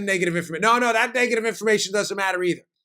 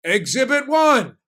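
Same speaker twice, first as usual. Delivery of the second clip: high, loud, slow exhibit call; announcing shout; 6 semitones higher, 4 dB louder, slower than usual. Real, not acted. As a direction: announce it like a courtroom reveal.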